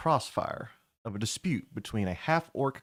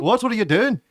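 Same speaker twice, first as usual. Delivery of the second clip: Melvin yelling the question back, much higher and louder; shouted repeat; 11 semitones higher, 10 dB louder than usual. Acted. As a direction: throw it back at him as a loud high yell. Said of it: "What was I doing?".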